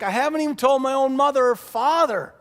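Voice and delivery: high, loud protest; exasperated. Retold or imitated. Samson protesting to his nagging bride, exasperated. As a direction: imitate an exasperated husband crying out.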